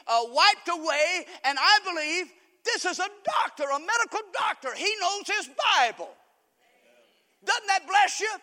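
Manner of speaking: high, loud, amazed testimony; fired-up preaching. Real, not acted. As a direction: marvel at it to the whole congregation. Excited preaching of retold testimony.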